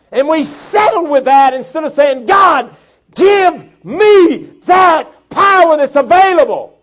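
loud, high fiery preaching; full-force preaching. Acted. Preacher thundering at the congregation about God's power, fiery. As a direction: rouse the congregation.

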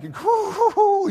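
loud, very high imitated hoot; high drawn-out hooting. Preacher imitates Filipino church members' speech, high mock hooting. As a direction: hoot it out long and high, exaggerated. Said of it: "they can say the word pastor like nobody else".

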